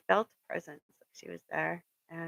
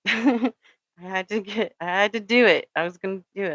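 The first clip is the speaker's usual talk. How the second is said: laughing determination; 9 dB louder than usual. Real, not acted. Cadet recalling beating the wall, laughing and loud. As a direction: push it out through laughter.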